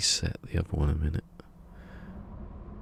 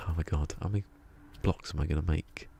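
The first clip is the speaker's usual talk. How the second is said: ordinary talk, nothing marked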